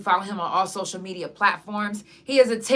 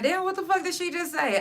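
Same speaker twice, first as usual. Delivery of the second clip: shocked viewer; 10 semitones higher than usual. Retold or imitated. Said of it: "y'all gonna be like".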